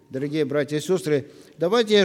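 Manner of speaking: ordinary talk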